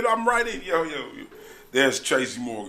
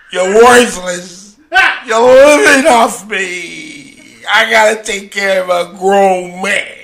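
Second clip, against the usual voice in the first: slow, louder berating; mock angry scolding; 5 semitones higher, 11 dB louder, slower than usual. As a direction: drag out the insults. Tracy cussing out his boy.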